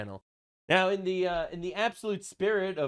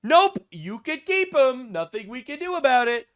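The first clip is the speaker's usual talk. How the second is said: mock refusal; 7 semitones higher, 6 dB louder than usual. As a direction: stretch out a breezy mock refusal. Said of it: "the Syrians are just like".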